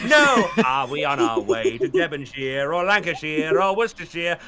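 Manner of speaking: grand theatrical English voice, rolling the place names; Matt Berry impression. Doing Matt Berry for chat, a hammy posh British voice. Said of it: "your Matt Berry impression".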